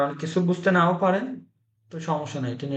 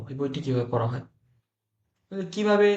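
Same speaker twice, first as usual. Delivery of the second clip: normal talking voice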